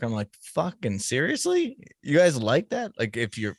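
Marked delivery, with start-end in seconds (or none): 0.5-2.9 incredulous. Retold or imitated. Retold reaction to accent fans.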